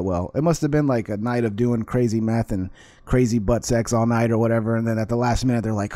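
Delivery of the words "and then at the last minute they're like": ordinary talk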